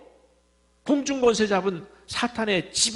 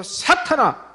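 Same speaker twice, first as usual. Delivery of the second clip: sharp rebuke; 7 semitones higher, much slower than usual. Acted. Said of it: "그 베드로를 향해서".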